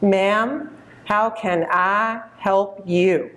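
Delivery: put-on slow, drawn-out Texas accent of a polite young salesman; Texas drawl. Young Texas clerk greeting a shopper, in a slow Texas drawl. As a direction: stretch the vowels like a Texas store clerk. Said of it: "he said in a nice Texas drawl".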